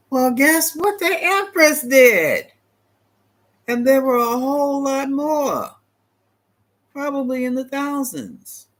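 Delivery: high, slow, sassy reveal; drawn-out mock gasp of scandal; sassy high aside. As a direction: deliver the gossip like a punchline. Sassy aside about the Empress.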